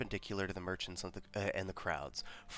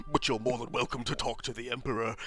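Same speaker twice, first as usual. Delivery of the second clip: higher, louder blustery clerk; smug fat bookkeeper voice; 6 semitones higher, 6 dB louder than usual. Acted. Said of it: "He laughs through fat lips".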